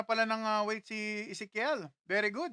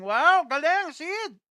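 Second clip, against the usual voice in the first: excited praise; 7 semitones higher, 7 dB louder, much slower than usual. Real, not acted. Cheering a viewer's weight loss.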